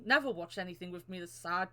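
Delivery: usual speaking voice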